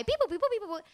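usual speaking voice

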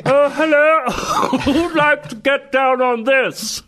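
high, drawn-out mock seductive voice; put-on high-pitched flirty call; hammed-up come-on. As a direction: squeal it slowly through laughter. Jokey put-on come-on amid laughter.

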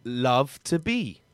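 hammed-up melodrama, word by word; word relay. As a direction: act the soap romance one word each. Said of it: "It's a one-word story".

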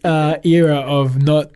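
hammed-up pomp about a losing team; mock grandeur. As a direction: announce the club's failures like a proud history. Joking about football club.

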